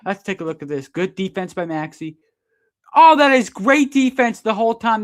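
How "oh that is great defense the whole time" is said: high, loud defiant praise; excited praise. Real, not acted. Commentator seeing the replay clear Maxey, loud high praise. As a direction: shout it like the replay proves you right. Replay shows clean defense.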